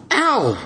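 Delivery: sharp high yelp of pain; sudden high ow of pain. Acted. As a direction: yelp as the needle jabs in. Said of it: "shouted Spike".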